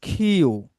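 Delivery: "খিউ" with an aspirated kh, not "কিউ". Shown as a demonstration. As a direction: breathe out on the kh of "খিউ". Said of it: "এটা কিউ বলবো না". ("খিউ" হাওয়া দিয়ে, "কিউ" নয়)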